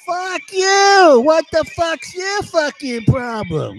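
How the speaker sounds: high, drawn-out mock shout; imitated yelling kid. Five-year-old cursing back at parents, high imitated yell. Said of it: "The kid is yelling at the mother and father".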